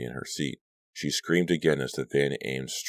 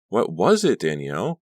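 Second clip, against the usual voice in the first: worried urgent question; 5 semitones higher, 6 dB louder than usual. A narrator's reading. Caroline worried after the scream.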